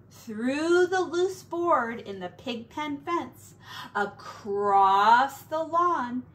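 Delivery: usual speaking voice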